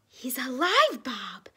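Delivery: loud on "alive", then dropping; mildly acted exclamation; excited cry. A narrator's reading. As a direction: cry out the discovery. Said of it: "cried Mark".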